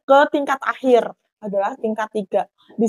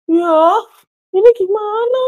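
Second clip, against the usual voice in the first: loud, high-pitched cry for help; flustered, raised-voice plea; panicked; 10 semitones higher, 7 dB louder than usual. Retold or imitated. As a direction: re-enact helpless alarm. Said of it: "aku di situ panik ya".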